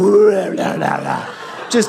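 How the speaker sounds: loud rattling vocal effect; imitated dog noise. Dog dives into the zone of death, imitated wild noise. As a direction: mimic a dog plunging in and wriggling about.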